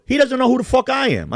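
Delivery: high, loud indignation; retold defiant outburst. Man answering warning about his rival, loud angry defiance. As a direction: shout it as a challenge.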